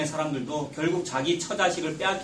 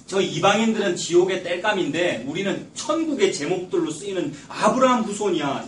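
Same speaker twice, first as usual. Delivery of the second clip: usual speaking voice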